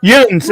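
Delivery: "yuns" squeezed into one syllable; the correct local "yuns", no break in the vowel; pronunciation of "yuns". Shown as a demonstration. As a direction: say "yuns" as one run-together syllable, rhyming with "buns". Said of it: "It's not "youans," it's "yuns".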